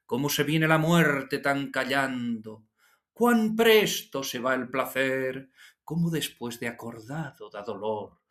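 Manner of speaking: hyperbolic declamation with shifts of register; Agustín García Calvo reciting. Retold or imitated. Teacher recalls a magnetic reciter, hyperbolic and changing register. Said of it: "Era un tanto hiperbólico".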